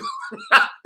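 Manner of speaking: mocking high-pitched laugh; imitated laughing. Showing how women laughed at the fiance, imitated laughter. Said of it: "and they were laughing".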